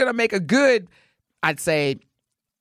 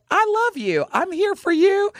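gushing fan; 12 semitones higher than usual. Pretend. Reading her fan T-shirt.

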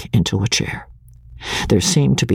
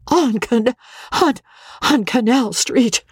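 wheezed; 12 semitones higher than usual. A narrator's reading. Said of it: "she wheezed".

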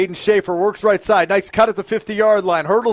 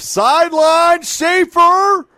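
excited; 12 semitones higher, 4 dB louder, slower than usual. Real, not acted. Play-by-play on a deep catch.

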